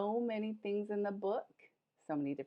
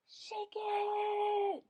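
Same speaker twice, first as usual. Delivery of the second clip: excited urging; 10 semitones higher than usual. Acted. Urging kids to shake book.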